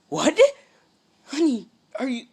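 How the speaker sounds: high, loud gasping disbelief; a mother who can't believe it; loud, astonished mom voice; shocked mother. Acted.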